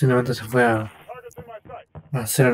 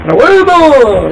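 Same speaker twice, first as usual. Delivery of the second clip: triumphant shout; 14 semitones higher, 12 dB louder, much slower than usual. Real, not acted. Sudden win cry while playing.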